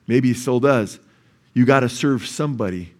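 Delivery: ordinary talk, nothing marked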